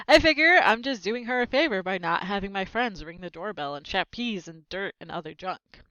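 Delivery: plain reading voice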